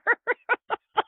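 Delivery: high, burst of real laughter; laughing. Real, not acted. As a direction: let a laugh escape, high. Laughing at her own doubt.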